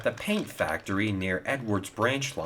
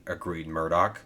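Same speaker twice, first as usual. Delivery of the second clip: ordinary delivery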